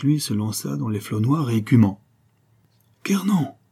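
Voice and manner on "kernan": very high exclaimed call; sudden high cry, falling away. A narrator's reading. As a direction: call the name out in alarm. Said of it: "s'écria le chevalier".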